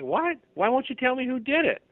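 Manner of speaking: can't believe what you just heard; disbelief. Pretend. Victim stunned by investigators' refusal.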